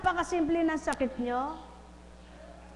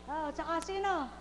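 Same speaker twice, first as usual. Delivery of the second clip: normal talking voice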